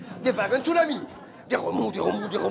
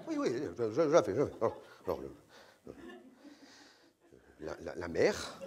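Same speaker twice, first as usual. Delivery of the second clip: much lower and softer; timid mumbling; 8 semitones lower, 9 dB quieter than usual. Retold or imitated. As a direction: stammer it out meekly. Didier intimidé répond. (Didier intimidé, bafouillant tout bas)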